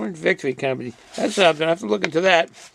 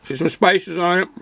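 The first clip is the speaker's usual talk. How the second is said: talking while chewing jerky; words around a mouthful of jerky; mouth full. Real, not acted. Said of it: "Sorry for talking my mouth full".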